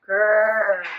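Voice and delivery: low throaty growl. Shown as a demonstration. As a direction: growl like a bear. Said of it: "Can you make a growling sound".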